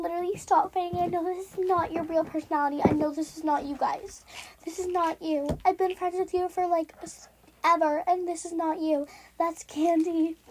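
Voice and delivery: mock-earnest girly peacemaker; pick-me girl. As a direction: plead with the boys in a put-on girly voice. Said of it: "I'm gonna do a pick me girl".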